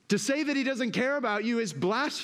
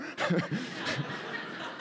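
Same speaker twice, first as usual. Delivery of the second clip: laugh; 5 dB quieter than usual. Real, not acted. Laughs at own quote.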